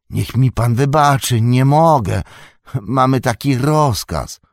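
loud, high, apologetic refusal; apologetic gatekeeper. A narrator's reading. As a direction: refuse while begging pardon. (strażnik przeprasza i odmawia, głośno)

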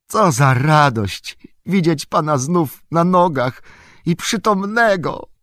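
high, loud burst of joy; overjoyed exclamation. A narrator's reading. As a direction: rejoice aloud, pitched right up. Sam rejoices at Frodo's recovery.

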